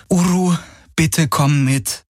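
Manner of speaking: high, slow coaxing; gentle pleading. A narrator's reading. Kirai coaxes her frightened mount, gently pleading.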